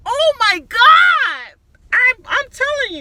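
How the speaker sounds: high, slow, shouted freakout; freaking out. Retold or imitated. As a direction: blow up screaming, drawn out. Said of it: "he blows up like".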